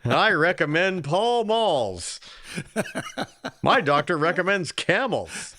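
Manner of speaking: put-on slow, old-timey doctor ad voice, laughing; hammed-up vintage cigarette ad doctor; fifties doctor. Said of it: "Sounded like a, like a fifties doctor".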